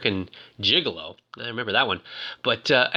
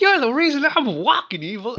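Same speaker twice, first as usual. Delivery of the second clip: high, breathless imitation of a thrilled boy; mock-excited high voice; excited kid imitation; 9 semitones higher than usual. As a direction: play an excited kid, pitched way up. Acts out a grateful kid's cry.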